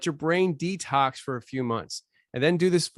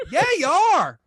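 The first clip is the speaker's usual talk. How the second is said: much higher, loud blunt "Yeah, you are."; blunt retort; 12 semitones higher, 7 dB louder than usual. Real, not acted. Deadpan reply to mock panic.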